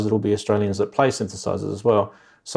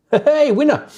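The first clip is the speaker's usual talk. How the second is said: excited cheer; 3 dB louder than usual. Real, not acted. Cheering viewer with a laugh.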